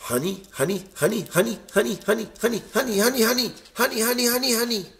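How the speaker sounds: excited repeated chant, accelerating and rising; greedy excitement. A narrator's reading. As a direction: lose control at the sight of honey, climbing to a high babble. Norman can't help himself at honey.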